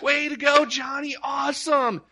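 doting dad praising; proud cheering. Retold or imitated. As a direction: cheer the toddler on. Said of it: "he was there going".

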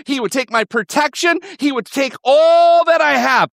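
high, mounting lament, peaking on "all"; anguished self-blame. Acted. Preacher voices Naomi's inner sentence, anguished and high-pitched. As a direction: pour out hurt in a high, insistent run. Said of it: "a sentence that was running through her head".

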